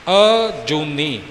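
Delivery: ਅਜੂਨੀ drawn out slowly, long ਊ, no doubled ਨ; slow correct model. Shown as a demonstration. Teacher shows learners ਅਜੂਨੀ, slow and careful. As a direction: stretch ਅਜੂਨੀ with a long ਊ, single ਨ. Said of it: "ਜੁੰਨੀ ਨਹੀਂ ਬੋਲਣਾ".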